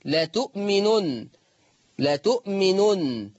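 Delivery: لا تؤمنن slow, the hamza softened to a long waw; Quranic recitation. Shown as a demonstration. Teacher recites for his students. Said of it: "إبدال الهمز الساكن بواو المادية".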